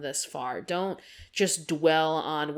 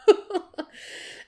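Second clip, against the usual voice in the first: breathy laugh. Real, not acted. Embarrassed about being traumatized.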